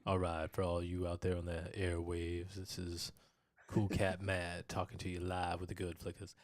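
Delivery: lightly voiced late-night radio host; a hint of a smooth DJ on air; sultry radio DJ voice. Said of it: "I'm gonna get my sultry voice on".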